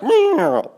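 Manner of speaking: squeaky high cat meow; high loud meow; mock meow. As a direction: hiss out a sarcastic cat noise. Imitating boyfriend's catty reaction.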